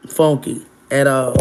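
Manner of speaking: normal talking voice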